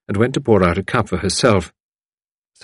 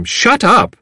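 higher, forceful scolding yell; shouted command; 13 semitones higher, 5 dB louder than usual. A narrator's reading. Mistress insisting to her barking dog, shouted. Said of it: "Tuppence shouted to him".

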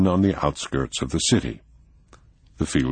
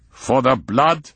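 sharp, slow retort, higher; snapped; 10 semitones higher, 4 dB louder, slower than usual. A narrator's reading. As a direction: bite the words out. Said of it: "snapped Prinzel".

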